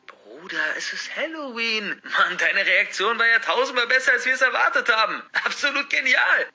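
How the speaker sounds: high, loud, gleeful; excited exclaiming. Acted. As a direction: cheer at the great reaction. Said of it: "ruft Patrick".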